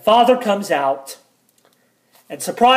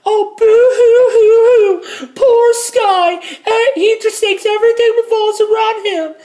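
mocking stepmom; 8 dB louder than usual. Stepmom mocking the narrator from the other room, shrill high boo-hoo. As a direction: whine it like a cruel sing-song taunt. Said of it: "making fun of me".

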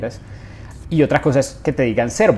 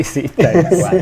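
laughing agreement; 4 semitones higher, 3 dB louder, much slower than usual. Real, not acted.

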